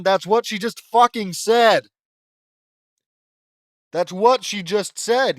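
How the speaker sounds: slow, loud, fed-up shout; exasperated shout. Real, not acted. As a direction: yell at the terrible writing. Furious at repetitive dialogue.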